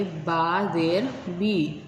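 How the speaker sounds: each letter drawn out slowly, syllable by syllable; slow, patient drill of letter plus zer; slow spelled recitation. Shown as a demonstration. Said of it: "तो इसे हम इस तरह पढ़ेंगे".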